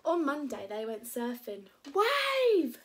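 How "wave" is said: high, loud warning shout. Acted. Max to Preston while surfing, high excited shout. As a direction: yell it long across the surf. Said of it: "shouted Max".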